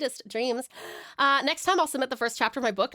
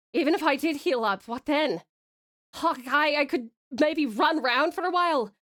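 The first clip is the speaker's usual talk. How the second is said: animated, arguing bad Scottish accent; amateur Scottish accent. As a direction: argue bitterly in a butchered Scottish accent. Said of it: "butchered it with my terrible accent".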